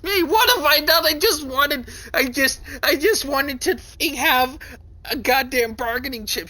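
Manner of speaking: high, loud, breaking voice near tears; distraught wail. Acted. Character despairs over what she has done, high crying wail. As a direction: cry it out in despair.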